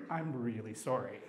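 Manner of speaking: low, soft mock-solemn apology; deadpan apology. Coworker revealing the cursed toy, quiet deadpan apology. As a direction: keep it hushed and straight for the punchline.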